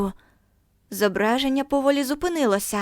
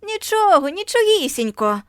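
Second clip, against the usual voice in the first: much higher, loud mocking cry; jeering chorus; 9 semitones higher, 4 dB louder than usual. A narrator's reading. Children jeering at Margo, high and loud. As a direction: chant it back at her.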